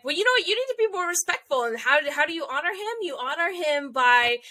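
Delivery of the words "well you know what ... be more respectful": high, prim lecturing voice; scolding Winry. Retold or imitated. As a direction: lecture him like a stern friend. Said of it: "and she's like".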